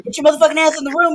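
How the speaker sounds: quick, loud, half-joking command; mock angry order. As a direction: rattle it off like an annoyed brother. Brother ordering him, retold.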